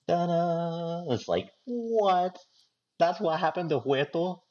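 high, slow hammed-up soap outrage; milk the twist like a soap star; telenovela shock. Telling cohosts about telenovelas, high mock-shocked drama. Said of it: "like watching one of those telenovela".

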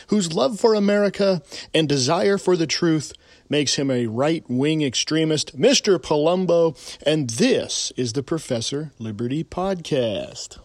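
ordinary talk, nothing marked

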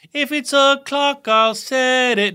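high, very slow hammed-up British voice; mock British accent. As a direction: ham up a cheery British accent. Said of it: "he was British, by the way".

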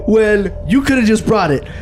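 high, slow yell broken by laughs; half-laughing retold blowup; yelling at the manager, laughing through it; losing it. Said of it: "I just lose my shit".

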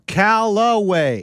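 CALLAWAY slow and drawn out; Callaway, slowly spelled out by sound; drawn-out correction. Retold or imitated. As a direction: stretch each syllable of Callaway. Corrects the retold exchange loudly.

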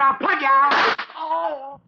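high, loud, slow strained cry; a last cry as the gun goes off; fatal struggle cry. Acted. Mike shot in the struggle, high loud dying cry.

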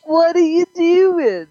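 high, loud, slow mock British call; mock British accent. S0 redoes the window yell in a mock British accent. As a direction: stretch it out like a cheeky Brit. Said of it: "That was my British accent".

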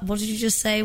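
ordinary talk

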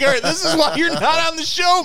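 high-pitched exasperated cry; exasperated retold outburst. Telling a friend why he's off the show, exasperated. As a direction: protest in a high, worked-up voice.

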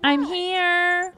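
high, lilting mimicry of a film line; movie character imitation. Recreating a Mulan clip as it plays, high mimicking voice. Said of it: "I think I can just recreate".

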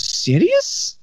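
put-on high, rising Egyptian-accented "Serious?". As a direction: play the Egyptian boss, incredulous and way up high. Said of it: "Pretty good Egyptian accent".

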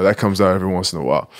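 usual speaking voice

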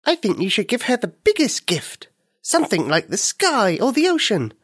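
lightly voiced friendly firefly, high; a tiny creature giving kind advice. Firefly character speaks.

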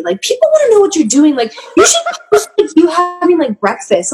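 high, fast eager dad voice. Retold or imitated. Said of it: "he was like".

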